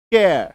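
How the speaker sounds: केयर said with the final r almost dropped; half-silent final r. Shown as a demonstration. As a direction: let the r in केयर fade away. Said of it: "र का उच्चारण पूरी तरीके से मत कीजिएगा".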